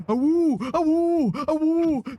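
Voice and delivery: high yelping howls; howling like a wolf pup. Acted. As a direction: howl it out like a wild pup. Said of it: "constantly screaming like a banshee".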